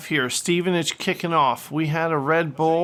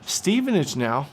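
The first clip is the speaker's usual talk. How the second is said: play-by-play commentary. Acted. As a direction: call the action like a TV commentator. Commentates his own FIFA match.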